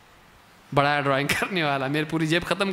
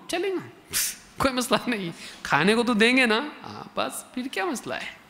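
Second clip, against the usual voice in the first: higher, laughing, shrugging it off; breezy child who couldn't care less; carefree laughing child; 6 semitones higher than usual. Retold or imitated. Careless child shrugs off threat. (बेपरवाह हंसता हुआ बच्चा)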